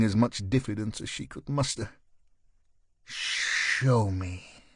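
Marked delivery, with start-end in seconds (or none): 3.0-4.6 purring. Acted. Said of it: "she purred".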